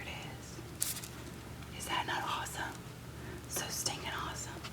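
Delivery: hushed, awed whisper. Real, not acted. Crafter whispering in awe at her shiny foil. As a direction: breathe it out in awe. Admiring the finished foil up close.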